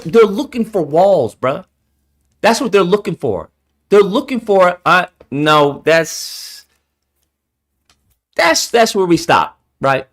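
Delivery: ordinary talk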